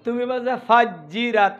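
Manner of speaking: ফাজিরাত said slowly with an open unrounded first vowel; correcting a student who says ফাজিরাত; imitating the mistake. Shown as a demonstration. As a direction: mimic a student's flat ফাজিরাত. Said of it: "ও ঠোঁট গোল করে নাই".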